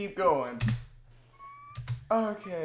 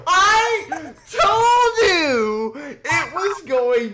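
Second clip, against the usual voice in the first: high, loud, slow laughing shout; cracking up; 10 semitones higher, 7 dB louder, much slower than usual. Real, not acted. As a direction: shriek with laughter through the words.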